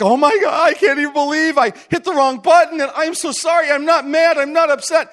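high, frantic mock apology; so apologetic. Caller who hit the wrong button, effusively apologetic. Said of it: "so apologetic".

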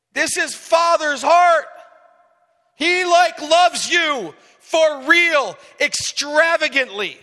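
impassioned sermon climax; preaching at full force. Real, not acted. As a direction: pour your heart out from the pulpit.

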